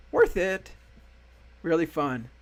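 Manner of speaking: excited. Real, not acted. Enthusiastic verdict on the show.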